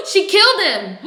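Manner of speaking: gasping, high and loud, genuinely stunned; shocked gasp. Real, not acted. Watching the show with viewers, high loud shocked cry. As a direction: blurt it out in real horror.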